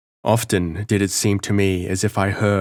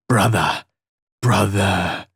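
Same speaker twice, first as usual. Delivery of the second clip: scornful and malicious; slower than usual. A narrator's reading. Mad voice mocking the prisoner, scornful and malicious. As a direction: sneer the word brother. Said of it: "it now sounded scornful and malicious".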